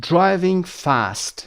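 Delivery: very slow, drawn-out "driving fast", every sound laid out; slow model phrase. Shown as a demonstration. Teacher modelling the phrase for students, very slow. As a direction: spell it out slowly so students can copy. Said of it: "teacher models phrase slowly".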